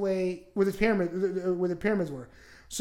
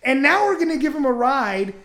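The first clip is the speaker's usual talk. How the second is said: higher, louder exasperated scolding; mock angry rant; 4 semitones higher, 8 dB louder than usual. Scolding the brother for offering a ride, mock angry. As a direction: chew out a reckless brother.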